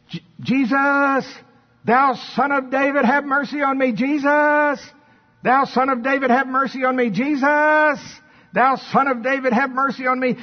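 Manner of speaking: high, sustained beggar's cry; blind man crying out. Acted. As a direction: call insistently over a crowd for mercy. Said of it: "And so he started going".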